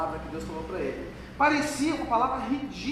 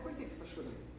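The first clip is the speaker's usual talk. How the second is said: gentle question; 3 semitones lower, 16 dB quieter, faster than usual. Acted. Asks the crying man gently.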